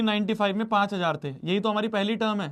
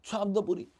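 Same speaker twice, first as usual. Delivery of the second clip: ordinary talk, nothing marked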